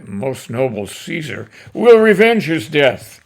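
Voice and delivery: loud cry for revenge; citizens crying out. Acted. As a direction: call out for revenge as a crowd. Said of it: "And the citizens cry".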